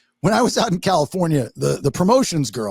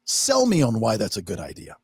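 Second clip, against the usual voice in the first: dismissive; 7 semitones lower, 4 dB quieter than usual. Retold or imitated. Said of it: "she leans back in her chair and she goes".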